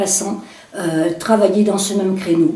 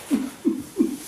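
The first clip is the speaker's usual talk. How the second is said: breathy laugh; 6 semitones higher, 9 dB quieter than usual. Real, not acted. End of comic sketch.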